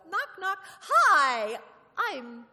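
bright, high, loud knock-knock greeting at the neighbor's door; cheery neighbor greeting. Pretend. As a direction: play a bubbly neighbor inviting you over, pitched high.

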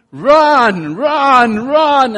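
loud high urgent cheering; screaming encouragement. Retold or imitated. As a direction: scream it to someone fleeing a dog. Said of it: "I'm gonna be screaming to you".

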